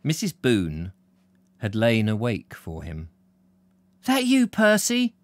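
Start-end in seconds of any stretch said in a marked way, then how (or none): 4.0-5.1 high-pitched mum calling out. Acted. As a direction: call through to your son, voice pitched right up. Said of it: "she called out".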